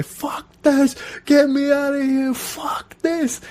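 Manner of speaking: high mock screams, panicked rider; hammed-up terrified yelling; imitated screaming. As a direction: shriek it like you're falling off a ride. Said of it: "he was screaming the whole way".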